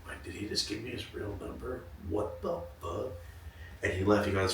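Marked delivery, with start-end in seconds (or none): 0.2-3.1 very soft, slowing disbelief; hushed acted astonishment; stunned inner thought. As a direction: murmur it to yourself, slowing at the end.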